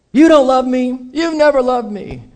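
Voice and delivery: high, whiny mock accusation; hammed-up wounded complaint; mock quarrel. Acted weekly quarrel, husband accusing.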